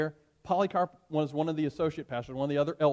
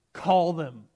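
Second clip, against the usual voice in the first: short, louder defiant retort; defiant reply; 8 dB louder than usual. Acted. Polycarp daring the proconsul to bring the beasts, defiant. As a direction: dare him back. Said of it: "Polycarp replied".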